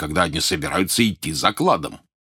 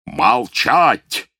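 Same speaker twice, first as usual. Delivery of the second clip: roaring; 4 semitones higher, 5 dB louder than usual. A narrator's reading. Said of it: "взревел Сильвер".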